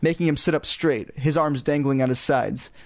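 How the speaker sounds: plain reading voice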